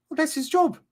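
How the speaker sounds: very high Irish pundit impression; Roy Keane. Streamer mimics a blunt pundit, high-pitched impression. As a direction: squeak out a pundit's dismissive verdict. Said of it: "you get Roy Keane".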